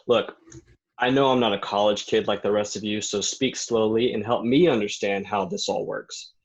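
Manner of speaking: ordinary talk, nothing marked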